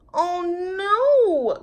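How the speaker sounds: high, loud cry of alarm; little bear crying out in alarm. Acted. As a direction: gasp it out as the bear sees the damage. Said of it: "cried Corduroy".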